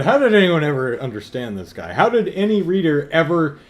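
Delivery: loud, high-pitched incredulous questioning; exasperated rant. Retold or imitated. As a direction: demand an answer in loud disbelief. Indignant rant resumes after aside.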